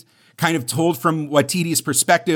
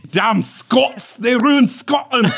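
irate cartoon Scotsman, high and shouted; Groundskeeper Willie; 7 semitones higher, slower than usual. Podcaster riffs for laughing co-host, angry cartoon Scottish shout. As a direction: rant in a hammed-up Scottish accent. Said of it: "That's just Groundskeeper Willie".

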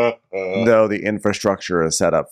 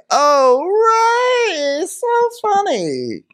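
very high, drawn-out gushing wife; Karen; 3 dB louder, much slower than usual. Retold or imitated. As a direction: coo it up high, stretching the last words. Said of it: "it's Karen and Ray".

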